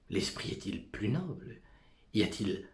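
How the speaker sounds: ordinary talk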